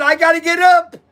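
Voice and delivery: retold protest to the wife. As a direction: insist you must get up, raising the voice. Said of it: "I said".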